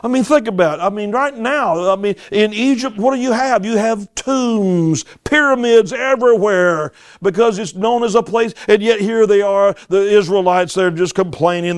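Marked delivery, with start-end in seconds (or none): none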